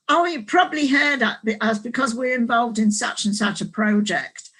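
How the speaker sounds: quoting the teachers with a fuller Australian lilt; Australian accent. Retold or imitated. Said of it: "this is my Australian accent".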